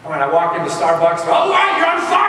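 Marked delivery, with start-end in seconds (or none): 1.2-2.3 imitating staff yelling a greeting, very high; excited shout. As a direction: shout it out high, like a thrilled barista. Starbucks staff greeting him, shouted.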